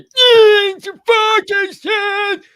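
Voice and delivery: very high, loud furious scream; mock meltdown at full volume; explosive screaming outburst. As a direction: blow up, screaming the words out. Acts out blowing up at bad news.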